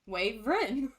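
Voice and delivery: "wyvern" said as WAYV-rin; demonstrated family pronunciation. Shown as a demonstration. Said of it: "pronounces it wyvern".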